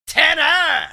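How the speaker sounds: loud high name call; big wrestling-style name reveal; announcer shout. Acted. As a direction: belt the name out like a ring announcer.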